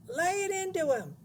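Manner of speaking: loud, very high screech; shrieking. A narrator's reading. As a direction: egg him on with a shriek. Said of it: "she was shrieking".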